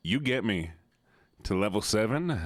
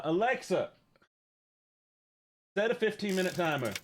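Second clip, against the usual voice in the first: higher-pitched command to a device; calling out; 7 semitones higher than usual. Real, not acted. Streamer asking Alexa for a timer, calling out. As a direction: call across to the voice assistant.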